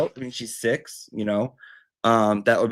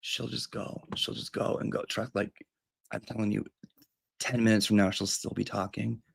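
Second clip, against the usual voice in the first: hushed aside; 8 dB quieter than usual. Pretend. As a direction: keep it down so the caller won't hear. Aside to viewers during the call.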